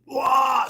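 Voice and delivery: high loud hawk-like cry; sudden high yell of excitement; excited whoop. Retold or imitated. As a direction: let out a stoked whoop. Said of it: "I'm kinda like a really".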